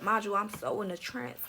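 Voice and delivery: ordinary talk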